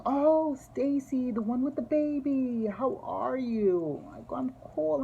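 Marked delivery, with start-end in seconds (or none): 0.1-4.1 much higher, louder, slow delighted woman's voice; high drawn-out excited hello to an old acquaintance; gushing caller. Retold or imitated. Voicing the caller on the phone. 4.4-5.0 much higher, put-on bright phone voice; high sing-song polite answer; chirpy reply. Voicing herself replying on phone.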